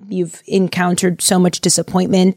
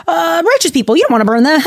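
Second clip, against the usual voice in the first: much higher, hammed-up worried pleading; anxious Abraham; 10 semitones higher than usual. Abraham nervously questioning God about Sodom, high mock worry. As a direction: squeak out nervous second thoughts. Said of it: "this way, like".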